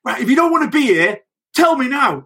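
lightly imitated boss, pitched high; mock manager. Manager tells players after a game, mock high voice. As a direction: play the manager, high and pushy. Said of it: "he'd go".

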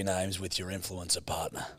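quiet unvoiced whisper. Real, not acted. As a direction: whisper the prompt. Whispered prompt to improviser.

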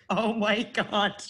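laughing. Real, not acted. Shocked laugh at the midwife joke.

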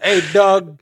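high, loud, swaggering English; mock rapper talk. Friend imitating the host bantering with the dog, loud high mock rapper voice. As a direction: play tough with a chihuahua. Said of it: "og spør sånn".

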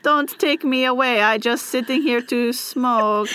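broken English in a put-on accent; fake foreign accent. Caught man begs not to be taken, fake foreign accent. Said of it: "why we're giving an accent".

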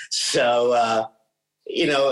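ordinary talk

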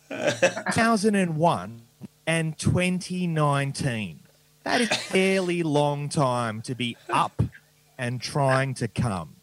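slow deadpan. Acted. Deadpan punchline build.

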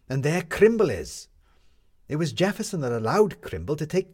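Maddie, sharp and matter-of-fact; firm young woman explaining; lightly voiced Maddie. A narrator's reading.

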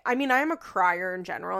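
usual speaking voice

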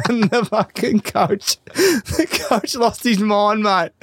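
high, slow, helpless laughter; laughing hard. Real, not acted. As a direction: struggle to get the story out through laughter. Cracking up at own story.

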